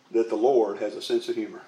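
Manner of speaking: usual speaking voice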